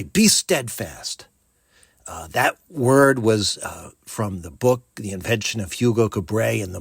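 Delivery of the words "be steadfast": slow encouraging. Shown as a demonstration. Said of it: "I'll say".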